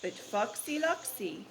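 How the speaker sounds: slow, dramatic read-aloud; story narration. A narrator's reading. Teacher finishing the story for the class, slow. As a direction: savour the ending for the children.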